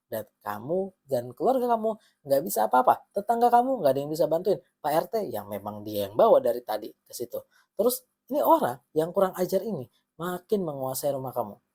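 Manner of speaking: ordinary talk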